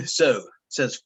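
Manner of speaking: ordinary talk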